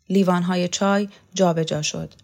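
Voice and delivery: ordinary delivery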